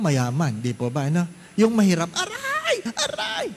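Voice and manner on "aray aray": very high squealing aray aray; mock cry of pain. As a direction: squeal like a poor patient in pain. Poor patient crying out. (matinis na sigaw ng sakit)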